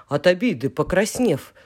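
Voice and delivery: usual speaking voice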